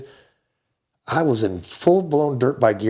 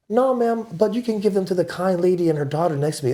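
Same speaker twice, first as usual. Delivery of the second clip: higher, overly polite; lightly voiced polite gentleman, higher; polite mock voice; 7 semitones higher than usual. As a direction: play the sweet gentleman. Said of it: "I go".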